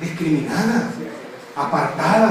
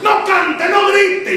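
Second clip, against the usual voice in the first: high shouted church scolding; scolding elder; 11 semitones higher, much slower than usual. Acted. Church elder yelling at a youth to stop singing, high shout. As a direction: bark orders at the lively youngsters.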